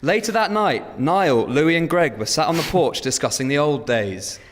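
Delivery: slow, bright scene-setting narration; story narration. Acted. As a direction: read it like a storyteller.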